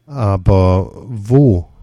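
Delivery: slow, lost plea; desperate. A narrator's reading. Confused old man asking where to go, slow and desperate. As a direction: ask helplessly, drawn out and slow. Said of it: "fragt Dieter verzweifelt".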